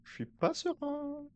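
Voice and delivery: high, slow fearful voice; mock scared. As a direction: whimper it out, pretending to be scared. Scared in horror game.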